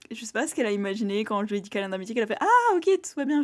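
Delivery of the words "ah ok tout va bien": high, loud, slow relieved reply. Retold or imitated. A friend relieved the hug is just friendly, high drawn-out relief. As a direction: let out an exaggerated relieved okay.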